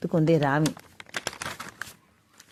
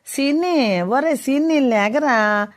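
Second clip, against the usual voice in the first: calling out; 7 semitones higher, slower than usual. A narrator's reading. Mother waking her son.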